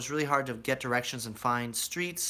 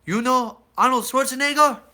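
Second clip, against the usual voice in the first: loud, eager mock foreign voice; imitating Georgian men; 4 semitones higher, 7 dB louder than usual. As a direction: ham up the excited locals greeting Americans. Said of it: "they'd be like".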